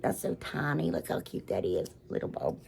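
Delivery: hushed whisper over something tiny; whispered cooing. Real, not acted. Showing viewers a tiny bulb, hushed whisper. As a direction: breathe it out like it might break.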